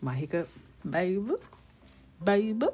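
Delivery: attempted New Orleans accent, rising "Baby". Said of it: "I gotta say baby in the Nola voice".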